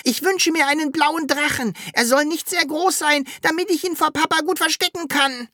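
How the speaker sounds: very high, hurried little creature voice; hasty call. A narrator's reading. Sams wishing into the machine's funnel, hasty high call. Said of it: "rief das Sams hastig".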